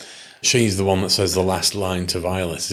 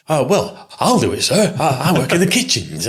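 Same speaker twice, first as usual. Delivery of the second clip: put-on high, keen kitchen boy, higher; eager servant voice; 7 semitones higher than usual. Parody eager servant volunteering.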